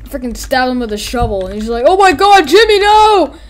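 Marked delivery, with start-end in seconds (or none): 1.9-3.4 loud, high melodramatic wail; hammed-up battlefield horror; mock horrified cry. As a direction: cry out for a falling comrade. Voicing horror at comrade stabbed.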